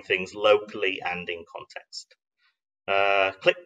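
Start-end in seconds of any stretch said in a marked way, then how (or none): none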